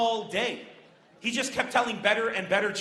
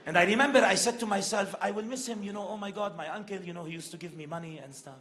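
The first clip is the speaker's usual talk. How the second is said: put-on old Lebanese man, wistful. Old man remembers his dead uncle, wistful Lebanese accent.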